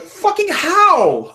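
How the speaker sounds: high, slow, drawn-out how; baffled disbelief. Retold or imitated. Mocking a fan's outrage to co-hosts, high slow disbelief. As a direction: stretch it in total disbelief.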